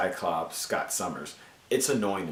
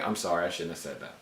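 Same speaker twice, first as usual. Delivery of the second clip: usual speaking voice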